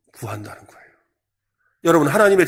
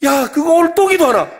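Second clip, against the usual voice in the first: much higher, loud fed-up neighbour; exasperated friend; 9 semitones higher, 6 dB louder than usual. Acted. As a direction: bark it out in disbelief, pitched way up. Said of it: "이게 뻔뻔한 거 아니에요". (질린 이웃이 훨씬 높고 크게 소리치듯)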